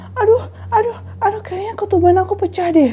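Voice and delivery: high, whimpery mock wail; pained, high-pitched complaint; woman in labour. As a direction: imitate a distressed patient in labour. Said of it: "si Dewi bilang".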